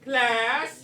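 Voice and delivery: "class" loud and long, with an extra L; drawn-out class. Real, not acted. Teacher calling students to attention, loud drawn-out "class" with extra L. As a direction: stretch "class" out, leaning hard on the L. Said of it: "Why do you say it with a class? ... that extra L".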